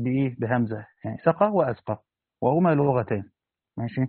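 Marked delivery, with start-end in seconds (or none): none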